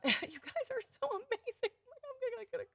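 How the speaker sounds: teary laugh, pitch jumping high; laughing through tears. Real, not acted. Host laughing, moved by fan comments, teary laugh. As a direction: laugh while fighting back tears. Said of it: "make me tear up".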